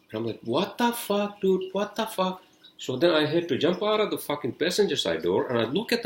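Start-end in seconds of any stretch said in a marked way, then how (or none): 0.4-2.4 high-pitched retold "what the hell" reaction; exasperated disbelief. Driver reacting to his jammed car door, exasperated disbelief. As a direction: pitch it way up, incredulous, without shouting.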